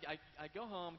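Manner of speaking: ordinary talk, nothing marked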